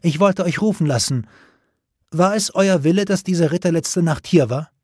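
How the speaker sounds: high-pitched, imperious questioning; lofty, demanding tone; haughty. A narrator's reading. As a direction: interrogate her as an offended queen. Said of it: "sagte sie hochmütig".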